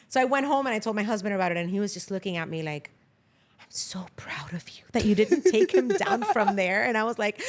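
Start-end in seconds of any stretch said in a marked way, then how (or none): 3.6-6.8 husband's whisper. Retold or imitated. Husband looking at her.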